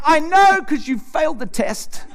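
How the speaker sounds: mock triumph at his wife, laughing; gloating laugh. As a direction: crow over her failing. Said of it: "I'm like".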